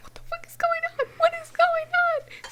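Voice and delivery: lost and terrified; frightened girl after the raid; panicked. Retold or imitated. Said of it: "and I was like".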